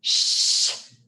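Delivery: urgent breathy shh; whispered shush. A narrator's reading. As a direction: hush her urgently in a whisper. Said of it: "whispered Jack".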